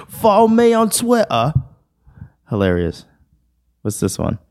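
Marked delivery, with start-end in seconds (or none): 0.1-1.5 put-on very high British accent, "Twitter" as "twi'ah"; mock British accent. Reading the British meme aloud, mock British accent. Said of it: "British people be like".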